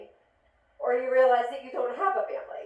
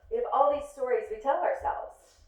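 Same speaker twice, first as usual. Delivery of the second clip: normal talking voice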